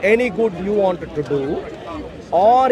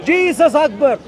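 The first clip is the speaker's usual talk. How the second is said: higher and a little louder, a half-hearted mock shout; 6 semitones higher, 3 dB louder, slower than usual. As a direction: toss it out like a pretend fanatic's cry. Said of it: "who is going around shouting out".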